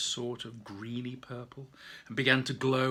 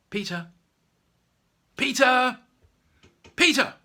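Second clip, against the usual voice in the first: rising, louder calls of a name; calling out; 10 semitones higher, 10 dB louder than usual. Acted. Teacher calling Peter awake in class, louder each time. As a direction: wake him with sharper calls.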